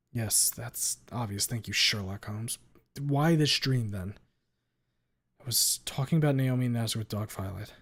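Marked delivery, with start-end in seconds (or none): none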